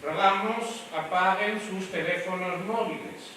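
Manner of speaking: polished, polite request to the audience; formal event hostess voice; polite announcement. Shown as a demonstration. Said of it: "Eso sería máscara social".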